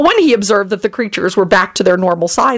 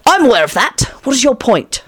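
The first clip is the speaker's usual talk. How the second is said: raised-pitch impatient comeback; testy retort; 6 semitones higher than usual. A narrator's reading.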